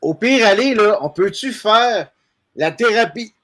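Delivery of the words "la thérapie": choking on a bug; coughing up a swallowed fly; just swallowed a bug. Real, not acted. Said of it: "j'ai avalé une mouche noire".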